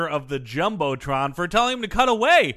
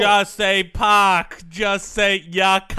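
put-on Boston accent; slower than usual. Mock Boston chanting continues.

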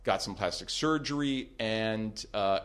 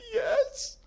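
high, tearful little yes; faint crying yes; 4 dB louder than usual. Retold or imitated. Stalker giving in on the phone, high and tearful. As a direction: squeak it out near tears. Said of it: "very faint kind of just".

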